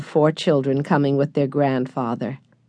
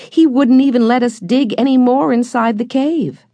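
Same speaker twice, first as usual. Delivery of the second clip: put-on higher, eager girl recalling the find; eager outcry; 8 semitones higher, 3 dB louder than usual. A narrator's reading. Jessie to her brothers, excitedly recalling the cave. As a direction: lift it up, keen to remind them. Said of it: "cried Jessie".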